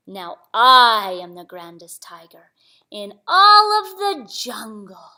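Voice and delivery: slow, grand tiger with a swelling loud ALL; boastful tiger. Acted. Tiger parading off in Samba's blue trousers, boastful and slow. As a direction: draw it out like a strutting tiger.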